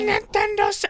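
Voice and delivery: put-on very high, ecstatic kid squeal; meme kid impression. Acting out a meme kid unwrapping a Nintendo, mock ecstatic squeal. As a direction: imitate a child screaming over a present. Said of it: "you ever seen the meme".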